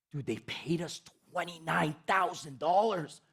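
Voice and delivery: slow, stunned retelling of disbelief; shocked disbelief. Retold or imitated. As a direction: stretch out the figure in mock shock. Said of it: "Why are you so surprised".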